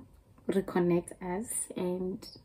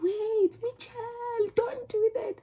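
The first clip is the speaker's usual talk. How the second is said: much higher, louder warning call; alarmed warning; 6 dB louder, slower than usual. Retold or imitated. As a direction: cry out to stop a friend. Friends warning her on road.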